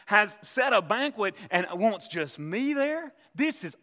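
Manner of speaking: high, loud, self-satisfied gloating; proud gloating thoughts. Retold or imitated. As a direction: puff up like a proud man rising in rank. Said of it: "He thinks".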